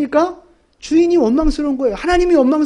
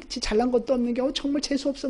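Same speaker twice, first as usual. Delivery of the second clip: much softer, disdainful grumble; muttered scorn; 10 dB quieter than usual. Retold or imitated. Believer grumbling about a lucky neighbour, muttered scorn. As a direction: sneer it under your breath. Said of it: "막 이러면서".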